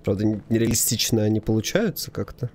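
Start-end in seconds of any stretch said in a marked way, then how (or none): none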